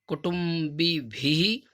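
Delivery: कुटुम्बिभिः stretched very slowly; drawn-out pronunciation. Shown as a demonstration. Teacher models कुटुम्बिभिः slowly for pupils. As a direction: sound out कुटुम्बिभिः slowly, syllable by syllable. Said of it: "इसका उच्चारण कैसे होता है".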